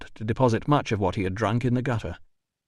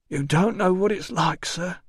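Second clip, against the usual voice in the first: put-on whiny drunk porter; 9 semitones higher than usual.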